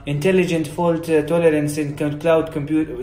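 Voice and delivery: halting, slow Arabic-accented English; broken Arabic accent. Shown as a demonstration. Conference speaker presenting to an audience, broken Arabic accent. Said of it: "لهجته مكسورة".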